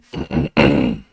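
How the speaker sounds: loud real throat clear; a rough, loud throat clear. Real, not acted. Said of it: "sorry for clearing my throat".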